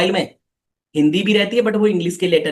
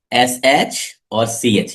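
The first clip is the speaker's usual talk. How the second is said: slow spelled-out letters; 6 semitones lower, much slower than usual. Shown as a demonstration. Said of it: "yes, not एच बेटा पर्व".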